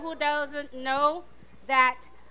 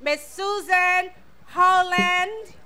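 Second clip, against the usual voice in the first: announcer introduction; 3 semitones higher, 7 dB louder than usual. Real, not acted. Host introduces guest to audience.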